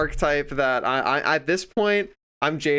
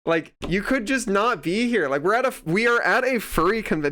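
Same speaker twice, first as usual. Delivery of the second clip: retold exasperated rant, pitched high; so mad; 7 semitones higher than usual. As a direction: demand an answer in higher-pitched indignation. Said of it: "it was making me so mad".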